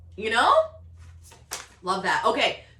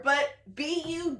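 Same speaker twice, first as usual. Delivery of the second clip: ordinary delivery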